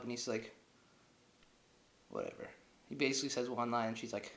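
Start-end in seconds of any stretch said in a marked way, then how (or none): 2.1-2.5 very low, softer one-word reply; dismissive low mumble; mock cool Bond. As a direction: drop deep for an indifferent hero. Bond's one-line answer.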